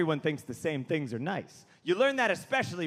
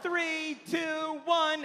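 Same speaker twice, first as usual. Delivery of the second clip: high, drawn-out countdown call; stage crew counting down on a raised pitch; 9 semitones higher, much slower than usual. Retold or imitated.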